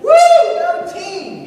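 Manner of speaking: high, hammed-up cheer; mock squealed pep-rally whoop; mock rah-rah cheerleader. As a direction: imitate a peppy cheerleader. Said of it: "That rah-rah cheerleader".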